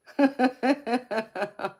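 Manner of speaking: loud, high burst of laughter; bright squealing laugh; high cackling laugh. Real, not acted. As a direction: cackle with delight at yourself. Laughing at own Southern twang.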